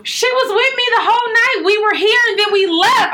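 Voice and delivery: high rushed alibi; nervous excuse. Retold or imitated. Lying to the angry boyfriend about the night, high and innocent.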